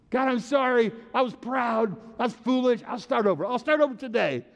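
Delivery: high, earnest plea to God; acted repentance. Sinner praying to start over, pleading repentance. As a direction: plead it like a penitent in prayer.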